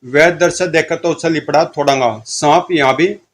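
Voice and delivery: usual speaking voice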